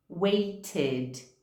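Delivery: "waited" ends in a full id syllable; teacher models "waited" as wait-id. Shown as a demonstration. Teacher shows the id ending. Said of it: "eu pronuncio esse id".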